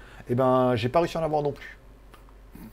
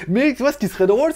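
ordinary talk

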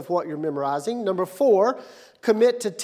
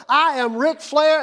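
higher, slower proud declaration; wrestler's announcement, pitched up; Ric Flair; 6 semitones higher, 4 dB louder than usual. Retold or imitated. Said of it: "declaring who he was".

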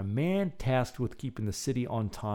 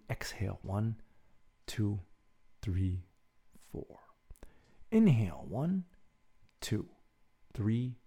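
guided breathing; 3 semitones lower, 5 dB quieter than usual. Acted. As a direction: count the breaths calmly and gently. Leads a breathing exercise.

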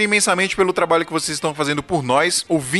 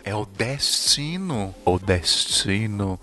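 tom dramático; 8 semitones lower, 5 dB quieter, much slower than usual. Pretend.

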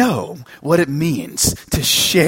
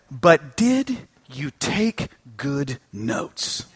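slow, softer, detached question; cold professor; 2 semitones lower, 4 dB quieter, much slower than usual. Retold or imitated. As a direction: drawl it out coldly. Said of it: "professor back home said".

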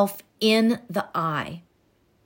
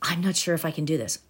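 hushed, fast doubting whisper; whispered self-doubt; 4 semitones lower, 5 dB quieter, much faster than usual. Retold or imitated. Coach voicing the timid inner doubt as a whisper. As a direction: murmur the doubt under your breath.

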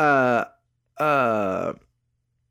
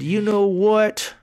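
slow buildup; 8 semitones higher, much slower than usual. Retold or imitated. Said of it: "I said".

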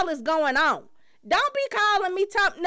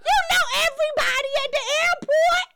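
mock yelling; 10 semitones higher than usual. Pretend wife yelling that the supervisor can come get him.

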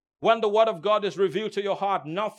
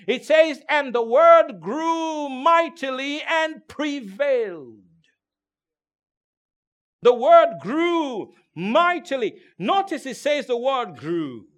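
loud, higher, slow emphatic preaching; full-force preaching; 6 semitones higher, 8 dB louder than usual. Acted. Preacher rousing the congregation about the word, loud. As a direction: build the sermon to a loud climax.

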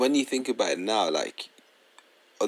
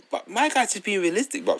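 ordinary talk, nothing marked

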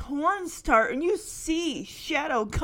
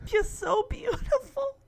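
high, tearful, moved voice; choked up; 10 semitones higher than usual. Real, not acted. Fan moved by the reunion, high tearful voice. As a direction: say it close to happy tears.

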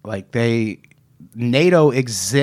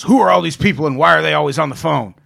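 mock FBI agent; 3 semitones higher, 4 dB louder, much faster than usual. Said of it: "the F B I brings it to him and goes".

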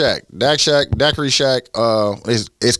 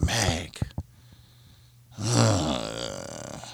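whisper; 7 semitones lower, 11 dB quieter than usual. Real, not acted. Stuck for something to say.

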